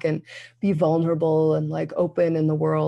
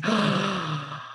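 acted-out gasp of relief; long, relieved intake of breath; 3 semitones higher, 4 dB quieter than usual. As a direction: gasp as if finally let off. Retold relief at bleeding.

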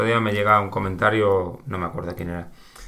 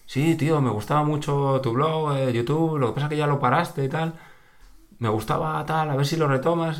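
higher, friendly fan voice; imitating an eager fan; 6 semitones higher than usual. Quoting the fan at the ski station, higher and eager. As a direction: play the fan who liked the blog. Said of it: "al principio fue como".

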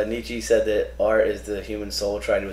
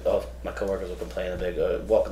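normal talking voice

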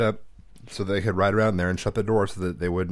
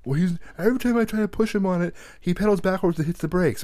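higher put-on kid voice, excuse-making; whiny big brother; 10 semitones higher than usual. Ezra making excuses to his dad, higher whiny kid voice. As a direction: play the defensive older son.

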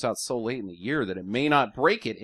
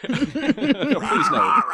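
laughing; 7 dB louder than usual. Real, not acted. Laughing at dinosaur request.

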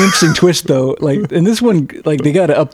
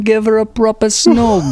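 a lightly put-on British accent, pitched up; mock British accent; 6 semitones higher than usual. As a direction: urge it like a cheeky Brit. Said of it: "work the word snog".

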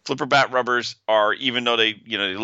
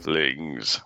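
lower, softer villain sneer; low mock-menacing emperor; Ming the Merciless; 6 semitones lower, 5 dB quieter than usual. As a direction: growl it like an alien emperor. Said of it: "in Ming's voice".

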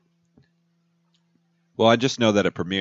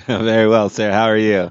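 louder, playful British reply; mock British accent; 5 dB louder than usual. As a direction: play along in a British accent. Answering a mock British greeting.

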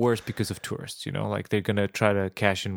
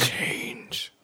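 hushed, drawn-out whisper; airy mock-mystic whisper; mock slogan whisper; 6 dB quieter than usual. Echoing the booths' slogan.

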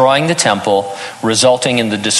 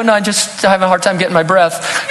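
higher, laughing denial; husband laughing off his tears to his wife; laughing excuse; 8 semitones higher than usual. Retold or imitated.

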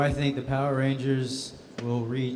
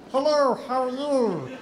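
high, slow, sing-song hello; goofy monster voice; 10 semitones higher, 4 dB louder, slower than usual. Retold or imitated. Actor clowning for cast members on set in a goofy voice. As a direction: goof around in the chicken monster voice. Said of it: "I'm being a goofball".

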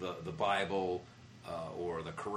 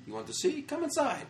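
imitated eager tout; 3 dB louder than usual. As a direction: coax them in, squeaky and insistent.

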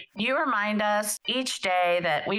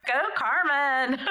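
bright laughing cheer, higher; 5 semitones higher than usual. Real, not acted. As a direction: cheer her on through a laugh.

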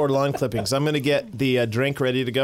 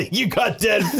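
laughing shout; 6 semitones higher than usual. Real, not acted. Friend got the dead fish bean.

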